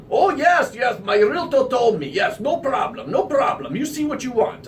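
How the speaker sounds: imitating a cheerful Russian owner waving it off; eager Russian accent. Owner brushing off the realtor's worry in a high, eager Russian accent. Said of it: "thick Russian accent".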